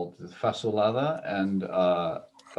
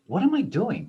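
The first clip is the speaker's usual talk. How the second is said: much higher incredulous question; self-mocking; 11 semitones higher than usual. Retelling her salt habit, higher mock disbelief. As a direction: ask yourself in comic disbelief. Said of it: "I go".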